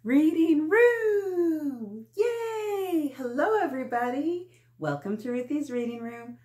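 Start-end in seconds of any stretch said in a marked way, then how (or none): 0.0-2.1 loud, drawn-out show-title announcement; excited title call. Acted. 2.2-4.5 put-on loud, high cheer to the kids; excited greeting.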